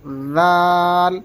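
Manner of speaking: long ذال with a soft voiced th as in "this"; very softly. Shown as a demonstration. Teacher shows correct reading, soft drawn-out ذال. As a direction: rest tongue on upper teeth and draw out ذال without buzz. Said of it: "بالکل نرمی سے".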